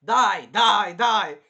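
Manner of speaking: loud repeated yell; shouting at the screen; reenacted viewer's shout, each word punched; bloodthirsty cheering.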